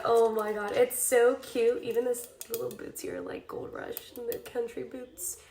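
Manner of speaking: ordinary delivery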